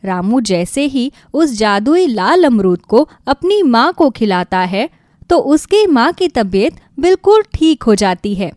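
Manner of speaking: plain reading voice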